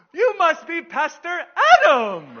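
very high, loud squeal of greeting; bubbly secretary. Retold or imitated. Secretary pops up to greet the pastor, imitated gushing. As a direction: burst out with hammed-up delight. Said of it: "this woman is the most bubbly".

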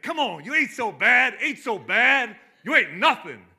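loud taunting shout; taunting Rocky. Retold or imitated. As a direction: bark the taunt at the opponent. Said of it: "he's like".

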